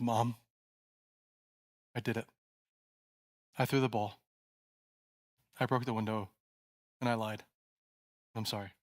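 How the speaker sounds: soft, trembling confession; barely above a whisper, shaking; quiet shaky voice. Acted. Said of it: "With a quiet, shaky voice".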